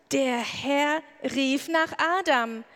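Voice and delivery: ordinary talk, nothing marked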